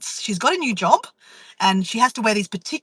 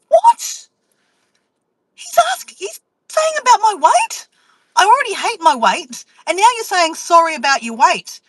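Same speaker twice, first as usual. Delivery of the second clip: high, loud, shocked wail; outraged inner scream, high and loud; shocked outrage; 8 semitones higher, 4 dB louder than usual. Retold or imitated. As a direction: voice cracking in horrified disbelief.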